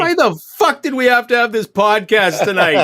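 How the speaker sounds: loud, high-pitched cry of frustration; exasperated outburst. Real, not acted. Bidder who missed his auction to co-hosts, loudly exasperated. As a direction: burst out in comic exasperation, voice climbing.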